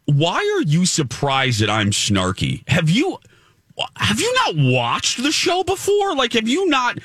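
rising, incredulous snark; exasperated high-pitched disbelief; snarky retort. Retold or imitated. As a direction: throw it back at her in disbelief. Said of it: "and I said".

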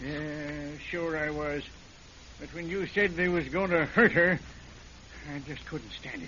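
low, soft admission; defeated. Acted. Caught killer admitting it to the deputy, low and defeated. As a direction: let the fight drain out. Confession continues.

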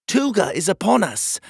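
high, loud warning; urgent warning. Acted. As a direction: raise the alarm.

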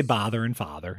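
"bother and father" with the same Wisconsin vowel; deep Wisconsin accent. Shown as a demonstration. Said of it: "That's my deep Wisconsin accent right there".

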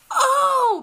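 very high, loud Oh; hammed-up high surprised Oh; fake surprise. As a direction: gasp it like sudden recognition. Sudden fake recognition.

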